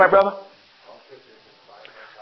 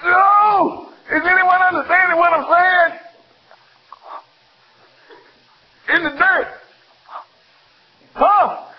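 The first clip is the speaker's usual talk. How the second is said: fervent exclaiming; 11 semitones higher than usual. Real, not acted. Preacher pressing the congregation, high fervent cries. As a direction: urge them, voice soaring.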